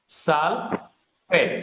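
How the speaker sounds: Sulphate drawn out very slowly, syllable by syllable; slow dictation. Shown as a demonstration. Teacher drilling the class on a term, slowly drawn out. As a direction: stretch sulphate for the class to copy. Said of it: "কি বলে?".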